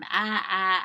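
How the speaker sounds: A, a as two short loud ahs like apple; short a sounds. Shown as a demonstration. Teacher leading the vowel chant, loud short a. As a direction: bark out the short a twice for the class. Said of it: "as I say the sound".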